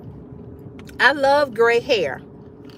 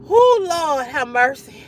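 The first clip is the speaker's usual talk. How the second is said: ordinary talk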